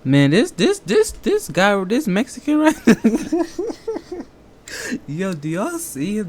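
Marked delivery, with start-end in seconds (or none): 0.1-2.8 laughing through the words; cracking up while talking. Real, not acted. Cracking up at clip. 2.8-4.2 loud laugh. Real, not acted. Reacting to clip.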